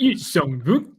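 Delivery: exaggerated mock accent on "Yuzong Vong"; hammed-up accent, laughing through it. Shown as a demonstration. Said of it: "please reprise your Yuzong Vong pronunciation".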